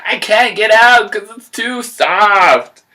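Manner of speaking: high, loud, slow words broken by laughter; laughing struggle. Acted. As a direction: laugh helplessly while stuck in the chair. Stuck in the soft chair.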